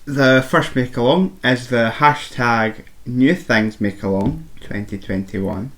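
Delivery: ordinary delivery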